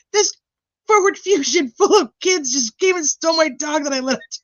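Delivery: high, whiny mock voice of an outraged owner; high whiny parody of the dog owner; mock indignant dog owner. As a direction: ham up the upset neighbour calling police. Mimicking the dog owner again.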